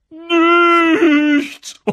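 very high, loud, stretched-out squeal; triumphant taunt. A narrator's reading. Peeves tricking Filch, triumphant taunt. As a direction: crow the single word gleefully.

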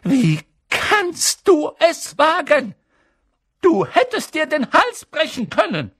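very high, loud, outraged scolding; zornig. A narrator's reading. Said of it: "funkelten zornig". (zornig, hoch und laut)

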